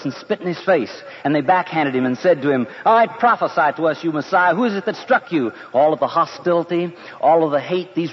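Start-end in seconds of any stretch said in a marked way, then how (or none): 2.8-5.5 raised-pitch jeer, hammed up a little; mocking taunt. Crowd taunts the beaten prisoner, mocking. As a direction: goad the victim with a high, mocking challenge.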